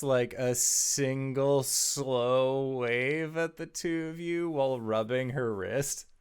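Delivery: deliberate, suspenseful storytelling; game master narration. Acted. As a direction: draw out the moment for suspense. Game master narrates a tense moment.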